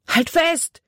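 high, urgent shouted command; called out. A narrator's reading. Jordan holds out a branch to Libby, calling out. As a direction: call it out over the rushing water. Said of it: "rief er".

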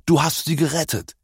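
high, joyful exclamation as the dog; delighted. A narrator's reading. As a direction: voice leaps up with happy relief. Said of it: "Auch Tassilo freute sich". (freudig und erleichtert ausgerufen)

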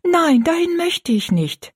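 put-on high, drawn-out girlish plea; hasty protest. A narrator's reading. Girl begs not to go to the workhouse, high and pleading. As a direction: plead against it, pitched right up. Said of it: "sagte Rosalie rasch".

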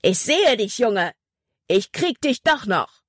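furious villain roaring across the water, pitched high; angry bellow. A narrator's reading. Hutton roars his threat at Jordan across the water, furious. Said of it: "Seine wütende Stimme brüllte über das Wasser".

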